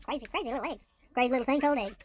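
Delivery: usual speaking voice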